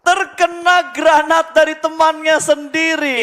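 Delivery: high, loud hype call; excited shout. Real, not acted. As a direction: scream the play-by-play. Hype call of teamkill grenade. (teriakan heboh)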